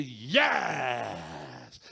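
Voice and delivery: long, loud cheer falling away; elated cheer. Retold or imitated. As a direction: whoop it out and stretch it. His delighted answer to the officer. (comemoração empolgada e esticada)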